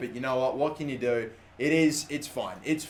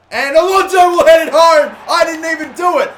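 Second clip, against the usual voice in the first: much higher, loud celebrating yell; goal shout; 11 semitones higher, 15 dB louder than usual. Real, not acted. Gamer celebrating a lucky headed goal, loud excited shout. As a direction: erupt as the header goes in.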